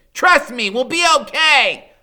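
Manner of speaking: high, loud call; urgent reassurance. A narrator's reading. Freak calling to his friend while running, loud reassuring shout. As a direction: shout it over the chase.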